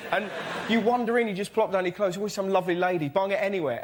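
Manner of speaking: ordinary talk, nothing marked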